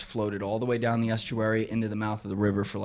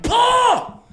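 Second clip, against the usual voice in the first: long, high, loud shout; desperate yell; 9 dB louder than usual. Retold or imitated. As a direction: shout his name in desperation. Yelling again after snooze.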